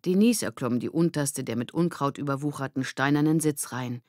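plain reading voice

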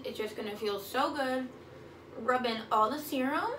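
talking while massaging face; hands pressing on cheeks. Real, not acted. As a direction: fingers pushing cheeks and jaw. Seen 0: Hands massage cheeks and jaw; mouth stays visible, not covered.

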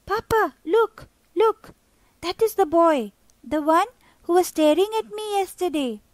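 put-on a kid pulling on his dad's sleeve; urgent, excited boy calling out to papa; eager young boy. Boy tugging father's sleeve.

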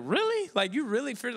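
mock disbelief. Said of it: "I was like".

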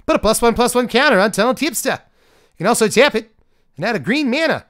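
high, loud mock New Zealand accent; hammed-up, badly faked New Zealand accent; terrible New Zealand accent. Card reviewer reading a card's rules for fun, bad New Zealand accent. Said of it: "terrible New Zealand accent".

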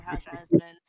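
low, flat-toned imitation of him; lightly voiced uninterested man; bored acquaintance. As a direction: voice his polite boredom, mildly. Other person's reaction voiced.

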